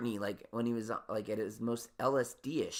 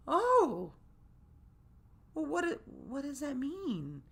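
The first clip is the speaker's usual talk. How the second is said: high, bright-eyed stylist asking; chirpy high salon voice; imitating the stylist, much higher; stylist's voice; slower than usual.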